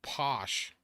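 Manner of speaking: Boston accent, Porsche said as "posh" with no r; slow Boston "posh", r dropped, short o. Shown as a demonstration. Streamer demos the Boston accent for chat, Boston "posh". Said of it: "They say, like, posh".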